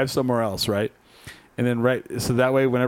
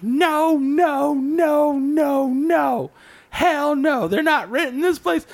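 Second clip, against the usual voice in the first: much higher, louder, a mock furious "no" over and over; hammed-up neighbor refusing from the lawn; imitating a yelling neighbor; 13 semitones higher, 3 dB louder, much slower than usual. Neighbor yelling at them on the front lawn, mock furious shouting. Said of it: "He's, like, yelling on the front lawn".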